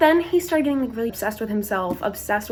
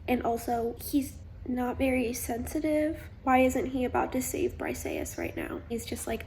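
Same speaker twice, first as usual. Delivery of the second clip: normal talking voice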